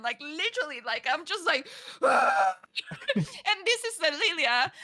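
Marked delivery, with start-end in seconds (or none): 2.0-2.6 short shouted scream; mock crazed scream. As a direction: re-enact a wild yell at the kid. Imitating own crazed outburst.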